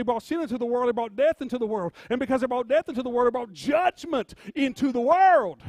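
loud preacher driving toward the last phrase; forceful preaching. Real, not acted. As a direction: build to a loud, emphatic declaration.

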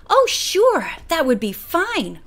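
bright, high "Oh, sure" that settles down; eager cry. A narrator's reading. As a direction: jump at the offer gladly. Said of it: "cried Bert".

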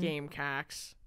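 Gamecocks in a lightly voiced Wisconsin accent. Shown as a demonstration. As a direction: say Gamecocks with a Midwest Wisconsin twang. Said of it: "Like that".

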